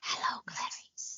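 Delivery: slow, soft creepy whisper; Hannibal Lecter whisper. Retold or imitated. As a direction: whisper it like a cannibal. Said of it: "reminded me of Hannibal Lecter".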